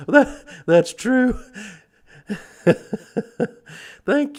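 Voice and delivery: high and loud burst of laughter through the words; real laughter, breathless giggle after; laughing. Real, not acted. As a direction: crack up as you agree. Laughs at a viewer comment.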